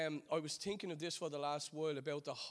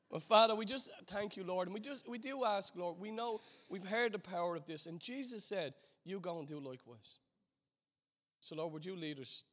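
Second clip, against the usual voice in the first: earnest prayer; 3 semitones lower than usual. Acted. Pastor leading the church in closing prayer, earnest and fervent. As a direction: pray it out to the Father, humbly.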